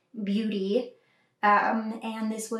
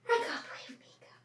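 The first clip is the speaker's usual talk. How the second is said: quick soft whisper; 5 semitones higher, 8 dB quieter, faster than usual. Real, not acted. Repeat whispered to puppy.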